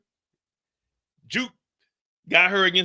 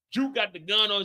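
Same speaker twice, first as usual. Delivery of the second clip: ordinary talk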